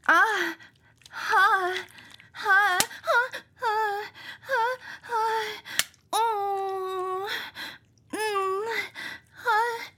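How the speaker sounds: high, sharp gasping cries; breathy gasps rising in pitch; pained gasps. Acted. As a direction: gasp as if it stings. Pained gasps, crying sound.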